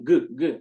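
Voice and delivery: г, г said as a sharp hard g stop; г, г clipped short as a hard g; crisp hard g. Shown as a demonstration. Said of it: "тут має бути чітке г". (чітке тверде ґ)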